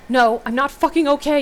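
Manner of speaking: high, loud, furious; yelling into a walkie-talkie. A narrator's reading. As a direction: bark it into the radio. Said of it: "you yell into the walkie-talkie".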